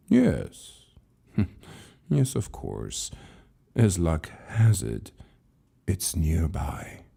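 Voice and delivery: a gentle chuckle, then calm reassurance; amused old keeper. Acted. Keeper agrees to show Lucas his own book, amused.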